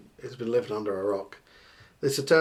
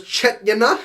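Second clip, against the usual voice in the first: higher, louder patois "Chet, you know" with a laugh; mock patois tag; 10 semitones higher, 7 dB louder than usual. Host quotes the clip's sign-off in patois. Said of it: "It's the bit where he goes".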